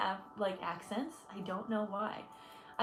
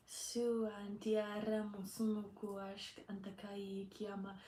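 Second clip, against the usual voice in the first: light language; 9 dB quieter than usual. Real, not acted. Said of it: "giving alien trying to be human".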